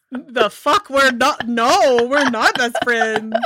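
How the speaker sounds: high, loud mock outrage at being called a friend; incredulous disbelief. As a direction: play his horrified denial, half laughing. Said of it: "he doesn't say it like this, but this is the attitude".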